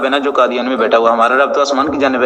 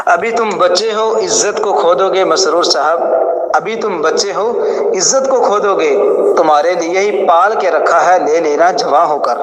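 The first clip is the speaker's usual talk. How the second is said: mocking taunt; 4 semitones higher, slower than usual. Real, not acted. Taunting the young opponent.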